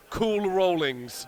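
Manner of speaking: hammed-up Jamaican voice; Jamaican accent.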